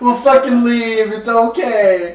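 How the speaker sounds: high, loud mock panic; panicked friend. Friend begging the drunk guy to leave, high panicked mock plea. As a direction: beg your buddy to back off.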